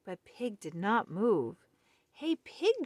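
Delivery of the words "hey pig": high call across to Pig; shouted. Acted. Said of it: "shouted Otter".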